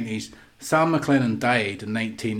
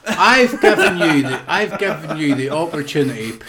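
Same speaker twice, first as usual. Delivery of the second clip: laughing; 3 semitones higher, 5 dB louder than usual. Real, not acted. Laughing at the pick.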